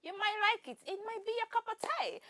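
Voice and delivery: high singsong voice; lilting, lifted pitch; singsong teaser. Real, not acted. Host wrapping up a film review, high singsong.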